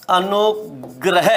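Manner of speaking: अनुग्रह drawn out slowly, every sound clear; slow correct pronunciation. Shown as a demonstration. Teacher to students, slow model pronunciation. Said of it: "वो क्या होगा".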